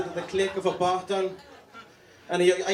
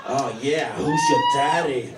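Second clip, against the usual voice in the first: louder mock bedroom shout; movie lover; 3 semitones lower, 5 dB louder than usual. Comic imitating the movie lover again, louder. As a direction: bark it like a hammed-up lover. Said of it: "why would you interrogate the girl".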